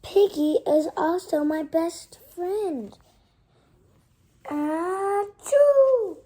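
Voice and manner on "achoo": louder mock achoo; child acting a sneeze; loud playful sneeze. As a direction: act out the sneeze. Acted sneeze.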